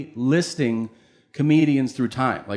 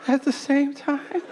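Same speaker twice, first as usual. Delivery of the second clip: stunned disbelief; 14 semitones higher, slower than usual. Retold or imitated. Child stunned by news.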